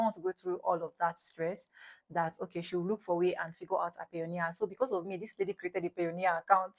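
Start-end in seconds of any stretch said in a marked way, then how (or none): none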